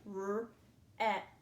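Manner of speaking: "R-E-P" as three separate sounds, rr, eh, puh; sounded out. Shown as a demonstration. Teacher breaks first syllable down, "R-E-P" sound by sound. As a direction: sound out "R-E-P" by phonics, not letter names, pausing between sounds. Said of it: "Let's practice spelling that syllable".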